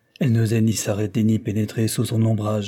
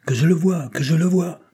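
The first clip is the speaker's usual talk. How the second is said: higher-pitched urgent plea; intense emotion; 7 semitones higher, 4 dB louder than usual. A narrator's reading. Hunted brother demanding to see the suspect, with intense emotion. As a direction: insist urgently, voice raised in pitch. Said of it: "tout en haletant et en proie à la plus vive émotion".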